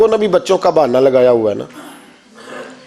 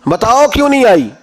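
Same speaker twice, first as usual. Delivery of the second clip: high, pressing demand; father pressing his wife for the truth; angry father; 5 semitones higher than usual. Retold or imitated. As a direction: insist on being told, in anger. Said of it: "غصہ آیا".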